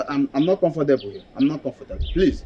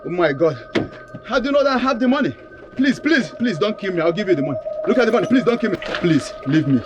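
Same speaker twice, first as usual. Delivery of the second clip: higher, rising into a scream; panicked pleading; 6 semitones higher than usual. Acted. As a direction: plead for life, then cry out. Victim panics at gunpoint.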